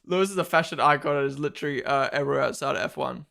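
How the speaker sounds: ordinary talk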